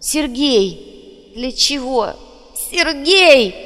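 high, distraught questioning building to a loud cry; grief-stricken, high and increasingly loud; anguished cries. A narrator's reading. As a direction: plead in disbelief, then cry out the name. Girl's horrified thoughts of lost Sergei.

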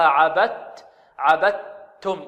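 عبدتم as "abattum", no trace of d; عبدتم with the د turned wholly to ت; correct idgham. Shown as a demonstration. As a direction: close on a pure t and hold it in عبدتم. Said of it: "فهكذا نقرأها".

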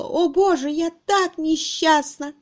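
high, wailing lament; choked misery. A narrator's reading. Miss Twitterton remembering her own misery, distraught. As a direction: wail in self-pity. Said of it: "Воспоминание о собственном несчастье кольцом сдавило ей горло".

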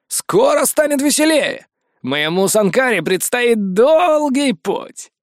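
high, slow, gleeful boast; gleeful god. Acted. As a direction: savour the coming fun. Sol gleefully predicts.

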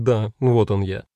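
ordinary delivery, nothing marked

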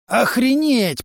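high, amazed exclamation; stunned mutter. A narrator's reading. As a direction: exclaim it in disbelief, pitched right up. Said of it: "пробормотал Лори".

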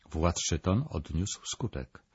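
ordinary delivery, nothing marked

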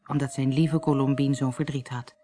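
ordinary delivery, nothing marked

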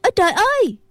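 high-pitched cry of alarm; startled cry. A narrator's reading. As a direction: cry out as if suddenly falling. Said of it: "hai nữ đệ tử la lên". (tiếng kêu hoảng hốt, cao giọng)

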